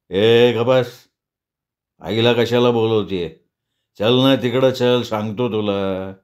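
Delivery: put-on low, dragging drunkard's order; drunken bully. Madhu stopping her from calling mother, drunken and bullying. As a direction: draw the words out low and menacing.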